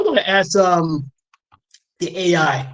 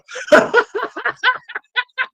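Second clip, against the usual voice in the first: long high laugh; high run of giggles; real laughter. Real, not acted. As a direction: crack up at your own joke. Amused by his own mock quote.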